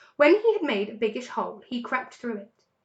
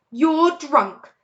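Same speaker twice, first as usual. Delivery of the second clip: scolding; 5 semitones higher, 9 dB louder than usual. A narrator's reading. Fox scolding Rat.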